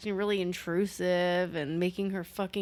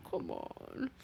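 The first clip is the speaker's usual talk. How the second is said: hushed, higher pleading; soft coaxing; 4 semitones higher, 14 dB quieter than usual. Retold or imitated. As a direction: coax very softly. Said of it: "He's like".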